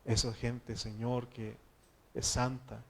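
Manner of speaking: loud, pleading prayer to the Lord; fervent prayer. Acted. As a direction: pray out loud with urgency. Pastor leads closing prayer.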